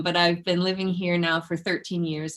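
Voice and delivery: usual speaking voice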